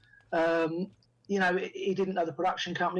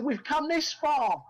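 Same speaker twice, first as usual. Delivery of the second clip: higher, drawn-out earnest appeal; insistent persuasion; 8 semitones higher, much slower than usual. Retold or imitated. Director persuading hesitant actor, insistent. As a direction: press the point slowly, pitched up.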